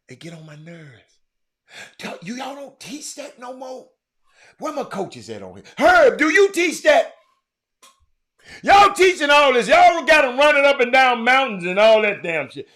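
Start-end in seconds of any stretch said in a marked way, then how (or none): 0.1-1.2 exasperated whisper. Real, not acted. Exasperated rant about players. 5.8-12.7 calling out coaches. Real, not acted.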